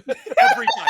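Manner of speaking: squealing with laughter; high, loud laugh through the words. Real, not acted. Laughing at a funny Vine.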